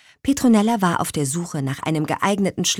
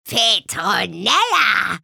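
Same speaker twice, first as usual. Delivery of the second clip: slow, drawn-out happy call of the name; old witch calls out gladly; delighted call; 3 semitones higher, much slower than usual. A narrator's reading. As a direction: call her name with joy. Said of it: "rief Tante Müffel erfreut".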